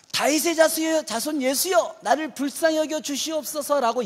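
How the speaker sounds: beggar's plea. Retold or imitated. Beggar crying out to Jesus.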